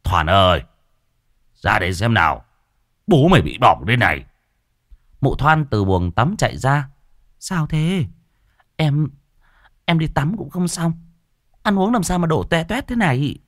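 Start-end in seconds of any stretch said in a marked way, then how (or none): 0.0-4.2 calling out loud, faster at the end; old man crying out. Acted. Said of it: "lão rú lên một tiếng". 7.4-13.4 wife's voice. Acted. Wife voiced in a story.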